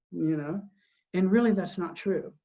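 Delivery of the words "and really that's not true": normal talking voice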